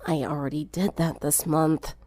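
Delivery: put-on a sick girl groaning from bed; sickly croak, barely up to it; groaned. A narrator's reading. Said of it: "Sunset groaned".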